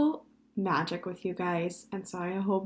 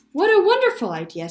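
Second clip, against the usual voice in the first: high, loud, quick delight; excited; 11 semitones higher, 11 dB louder, faster than usual. A narrator's reading. Mother praising son's frog idea, excited. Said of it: "said Missus Franklin excitedly".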